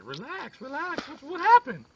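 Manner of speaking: high mother voice soothing then asking; fussy mom. Acted. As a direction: mom hushing her son.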